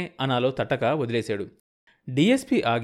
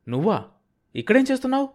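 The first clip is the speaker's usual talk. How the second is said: shocked question leaping much higher; high, startled disbelief; stunned, forcing words out; 11 semitones higher, faster than usual. A narrator's reading. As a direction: find your voice after freezing in shock. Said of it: "గొంతు పెకిలించుకొని అన్నాడు".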